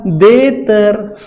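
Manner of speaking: देतर drawn out slowly with dental t; slow deliberate देतर; slow spelled-out reading. Shown as a demonstration. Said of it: "उसको मैं क्या बोलूंगा".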